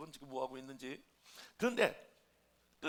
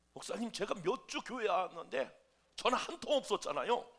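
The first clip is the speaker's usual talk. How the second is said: louder, acted-out reproach; hurt complaint; 5 semitones higher, 7 dB louder than usual. Young member reproaches pastor for never phoning, hurt complaint. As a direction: protest as the hurt church member. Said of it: "너무너무 섭섭해요".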